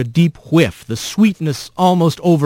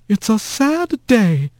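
slow, sour and higher, a lightly voiced prim old mouse; sour old aunt; 9 semitones higher, slower than usual. A narrator's reading. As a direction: drag out the words with sour disdain. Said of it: "a sour and all too familiar voice said".

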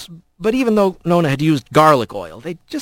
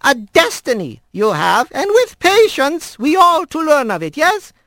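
put-on old squirrel grandmother; 12 semitones higher than usual. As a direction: coax him like a fond old granny. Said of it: "and said in Manx".

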